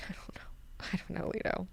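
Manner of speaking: soft whisper, barely voiced; whispered shrug. [name] who can't answer the quiz, whispering. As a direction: breathe it like a silent shrug.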